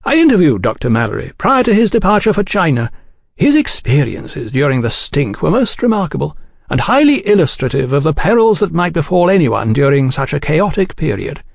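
high, lilting gentlemanly explanation; cordial gentleman journalist. A narrator's reading. Oliphant describing his book to the agents, cordial. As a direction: explain your work with smooth courtesy.

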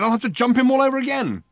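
high, loud outrage; raging. Acted. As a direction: rage at him for missing it. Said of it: "raged Ford".

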